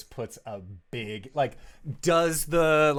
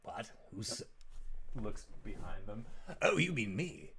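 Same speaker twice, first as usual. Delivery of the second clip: much softer, faux-innocent demon; mock surprise; 6 dB quieter, faster than usual. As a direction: play coy at being addressed. Rakdos feigns surprise.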